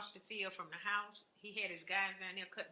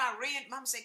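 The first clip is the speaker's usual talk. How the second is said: usual speaking voice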